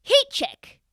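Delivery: imitated dad, high and loud; dad calling out. Dad gets the host's attention from behind, imitated high loud call. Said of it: "the dad's like".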